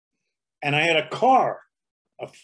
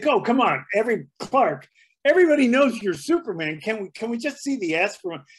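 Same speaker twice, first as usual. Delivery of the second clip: eager coaxing; 7 semitones higher than usual. Retold or imitated. Host coaxing Clark Kent on stage, eager and pleading. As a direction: egg him on to reveal it.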